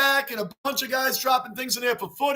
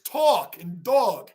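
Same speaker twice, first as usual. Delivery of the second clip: slow New York "aw" in "talk" and "dog"; New York accent; 3 semitones lower, much slower than usual. Shown as a demonstration. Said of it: "New York accents and Boston accent".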